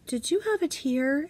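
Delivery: imitating curious kids. Kids asking teacher gently.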